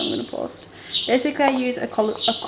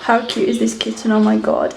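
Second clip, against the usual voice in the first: ordinary talk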